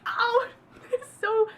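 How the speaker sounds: high, slow laughing squeal. Retold or imitated. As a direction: crack up mid-word. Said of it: "I'm like".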